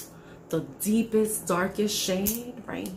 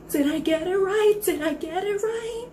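excited squeal; 9 semitones higher than usual. Acted. Caller excited after verse.

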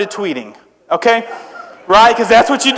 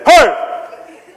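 manly yell; 4 semitones higher than usual. Shown as a demonstration. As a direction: bark it like a tough man scaring intruders off. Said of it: "yell this in a really manly voice".

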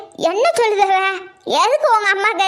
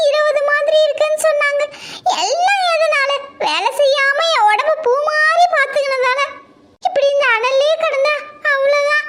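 higher, aggrieved self-pitying daughter-in-law; fed-up daughter-in-law; 6 semitones higher than usual. Acted. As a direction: rant like a pampered bride worn out by housework. Daughter-in-law rants alone.

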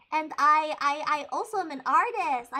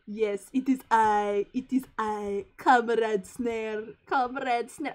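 low, slow mock Russian comrade; bad Russian accent; 6 semitones lower, 3 dB louder, slower than usual. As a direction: put on a rough Russian accent, drawn out. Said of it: "I can't do a Russian accent very well".

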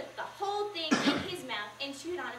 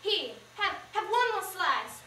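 louder, higher, pushing the pie; desperate offer; 5 semitones higher, 6 dB louder, slower than usual. Acted. Mama shoving another slice at Pete, desperate and louder. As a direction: urgently press another slice on him. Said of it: "She seemed almost desperate".

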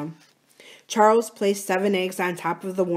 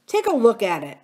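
earnest, higher and louder plea; earnest appeal; 5 semitones higher, 5 dB louder than usual. A narrator's reading. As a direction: urge your brother in mild earnest. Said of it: "Adam said earnestly".

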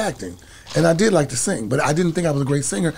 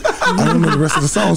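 normal talking voice

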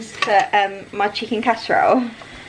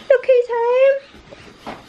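high, very slow sing-song hello; sing-song greeting; 4 dB louder, much slower than usual. Real, not acted. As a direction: stretch it out, high and playful. Greeting husband coming in.

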